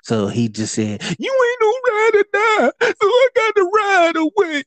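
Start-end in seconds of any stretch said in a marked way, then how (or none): 1.2-4.6 very high falsetto mock quote; exaggerated high-pitched croon; mock singsong. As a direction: squeal it out in a silly high falsetto. Mock quote of the breakup line.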